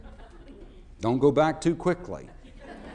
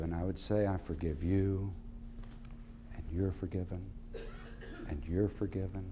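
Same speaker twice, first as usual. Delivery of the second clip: low, hushed and tender, softer throughout; soft sermon voice; 10 semitones lower, 9 dB quieter than usual. Acted. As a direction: speak forgiveness gently to the crowd, almost hushed. Softly offering forgiveness to the audience.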